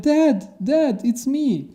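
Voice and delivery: very high, desperate call to dad; pleading son. Retold or imitated. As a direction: plead with your father. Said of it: "He's like".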